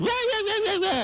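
high, mocking falsetto yeahs; dismissive high-pitched yeah yeah yeah. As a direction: brush her off with a squeaky sing-song. Host mocking the caller.

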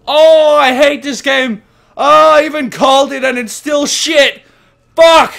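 loud frustrated ranting; angry yelling. Real, not acted. Streamer raging at the horror game, yells.

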